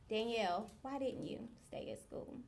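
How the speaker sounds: soft imitated questioning; hushed reproach. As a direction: let the question fade to very quiet.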